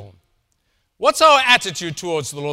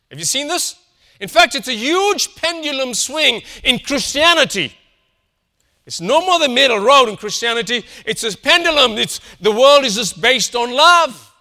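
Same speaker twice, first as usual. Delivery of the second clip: preaching at full force; 6 semitones higher, 7 dB louder than usual. Acted.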